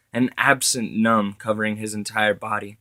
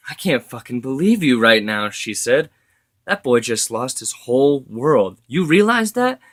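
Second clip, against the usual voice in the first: higher, louder, tearful outrage building up; crying anger; 3 semitones higher, 5 dB louder than usual. A narrator's reading. Joanna on the phone berating John about the boy, tearful fury. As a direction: rage at him through tears, rising. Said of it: "She was crying".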